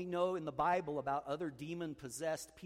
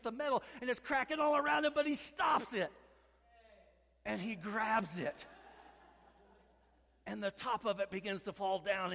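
high, loud, driving story build; climactic preaching; 11 semitones higher, 4 dB louder than usual. Acted. Preacher thrilling the congregation with the plane rescue, loud and high. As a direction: preach the crash like a blockbuster.

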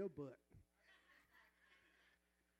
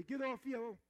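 shouted, higher, louder; preacher rallying the church, shouting; call-and-response shout; 6 semitones higher, 7 dB louder than usual. Real, not acted. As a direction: lead the crowd in the chant. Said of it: "Say it with me".

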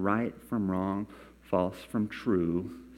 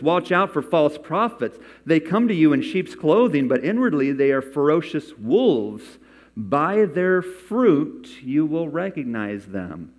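loud, raised, emphatic Bible reading; scripture reading; 5 semitones higher, 6 dB louder, slower than usual. Acted. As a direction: proclaim the warning to the congregation, high and forceful. Reads Jesus's warning aloud.

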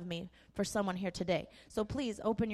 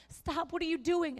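alarmed mom; 8 semitones higher than usual. Retold or imitated. Mom alarmed at jumping daughter.

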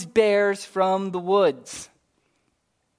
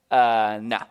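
laid-back Elijah; 8 semitones lower than usual. Acted. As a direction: shrug off the threat like it's nothing. Elijah voiced refusing again.

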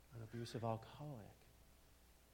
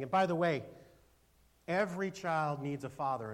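shouted aside, strong push; loud preaching burst; 4 semitones higher, 10 dB louder than usual. Real, not acted. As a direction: punch it out to the room.

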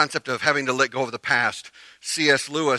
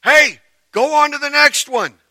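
high, loud impatient yell; imitated yelling; 14 dB louder than usual. Preacher as sister urging him along the bars, yelling. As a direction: holler it up at a stuck kid. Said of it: "My sister and others are yelling".

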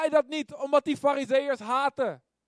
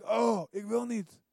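reluctant whine; 5 dB quieter, slower than usual. Retold or imitated. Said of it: "ik denk".

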